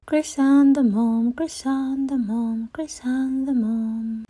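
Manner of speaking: high, fading, dreamy call of the flowers. A narrator's reading. Flowers whispering her name as she walks to school. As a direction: let the name float away softly. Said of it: "the flowers seemed to say".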